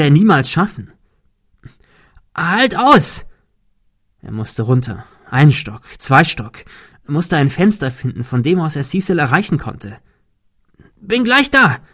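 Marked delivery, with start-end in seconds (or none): 2.3-3.3 much higher, loud cry; urgent shout. A narrator's reading. Thomas shouting to Cecil to hold on. Calling down to dangling friend. 11.0-11.8 much higher, loud call; urgent shout. A narrator's reading. Thomas shouting to Cecil that help is coming. Calling down to dangling friend.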